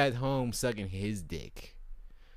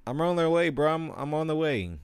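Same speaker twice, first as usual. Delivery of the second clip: high, loud mock reassurance; flaky friend's promise; 8 semitones higher, 6 dB louder than usual. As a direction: play the liar friend, pitched up and pushy. Friend's third fake promise.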